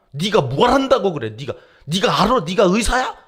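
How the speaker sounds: loud, high-pitched angry challenge; angry outburst. Acted. Leper patient lashing out at the examining priest, angry shout. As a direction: explode at them in indignation, voice jumping up loud. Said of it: "화를 내는 사람은".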